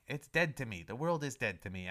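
usual speaking voice

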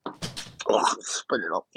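coughing after a strong drink; mouth burning from a drink. Real, not acted. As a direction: choke it out after a swig.

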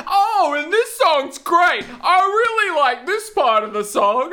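high, booming oblivious shout; loud headphone wearer. Retold or imitated. Mimicking a friend talking over music in headphones, very loud. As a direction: blare it slowly over imagined music. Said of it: "God, you're loud".

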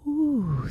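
loud, high delighted ooh; high excited ooh. Real, not acted. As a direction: squeal a rising ooh of delight. Reaction to feeling love.

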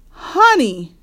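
high, loud scolding cry. A narrator's reading. Mama as Honey throws her oatmeal, crying out. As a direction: call out sharply to the child. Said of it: "cried Mama".

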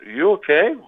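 retold check through a locked door; concerned calling out. Acting out asking barricaded companion.

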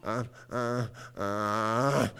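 low, soft repeated moans; hammed-up suffering moans; mock groaning. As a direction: groan as the symptoms build. Acts believer suffering.

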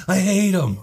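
loud, high-pitched hammed-up protest; indignant retold outburst. Brother blaming brother to mom after the fight, indignant outburst. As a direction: blurt it high and loud, mock-outraged.

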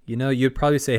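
ordinary talk

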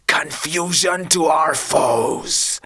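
slow, lightly voiced mock hiss; a villain's slow muttered curse. A narrator's reading. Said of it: "hissed Victor".

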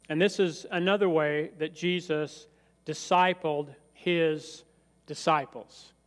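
normal talking voice

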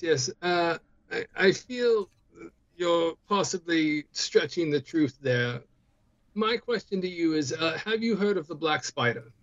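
ordinary delivery

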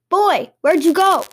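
high, loud, drawn-out call. A narrator's reading. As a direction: call after someone who has vanished. Goblin calls for vanished boy.

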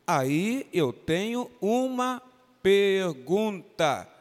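usual speaking voice

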